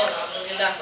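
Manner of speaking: usual speaking voice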